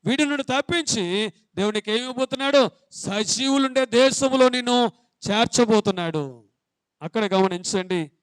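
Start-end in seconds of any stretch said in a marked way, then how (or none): 0.1-6.5 loud, raised preaching call to the congregation; fiery preaching. Acted. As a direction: preach it out hard to the church. Preacher opening at full force.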